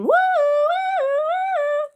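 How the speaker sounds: high, loud siren-like "woo"; playful screaming whoop. A narrator's reading. Baby runs away from dad, gleeful high scream. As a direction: whoop it in long, high waves.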